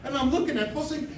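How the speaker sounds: ordinary delivery, nothing marked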